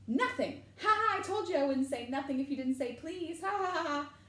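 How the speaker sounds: high, loud cackling glee; gloating laughter. A narrator's reading.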